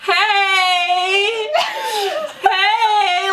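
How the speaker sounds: hammed-up call for attention; mock loud hey. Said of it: "she goes".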